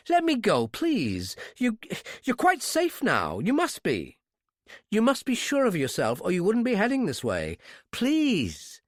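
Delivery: put-on high, begging young woman; pleading girl. Captive girl pleads with her kidnapper to release her. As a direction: beg him to let her go.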